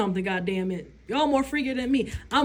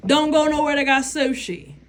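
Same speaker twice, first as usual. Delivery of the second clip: ordinary talk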